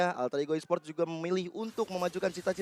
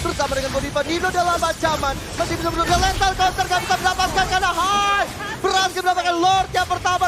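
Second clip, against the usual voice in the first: loud, much higher hype call; fighting game announcer; 12 semitones higher, 8 dB louder than usual. Real, not acted. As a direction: call the clash at full pitch. Peak teamfight casting.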